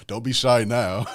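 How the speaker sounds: put-on teasing in a London Jamaican accent. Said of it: "he did the Jamaican London accent".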